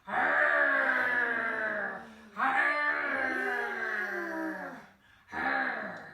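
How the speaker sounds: loud, drawn-out monster roar; full-force pretend monster growl. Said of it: "Monsters go".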